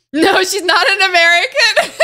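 loud, high, laughing protest; laughing retort. Real, not acted. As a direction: protest loudly, breaking into laughter. Laughing retort to chat teasing.

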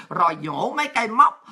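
normal talking voice

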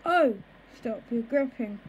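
plain reading voice

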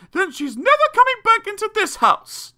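very high, loud outraged mum; hammed-up mother laying down the law; indignant mother declaring. A narrator's reading. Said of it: "declared Missus Frightfully Busy".

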